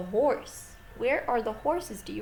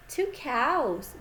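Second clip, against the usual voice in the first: usual speaking voice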